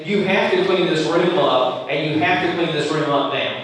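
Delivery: ordinary delivery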